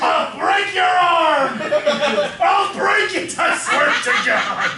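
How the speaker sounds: loud hammed-up pirate threat; mock angry shout. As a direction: roar the threat while laughing. Captain mock rage.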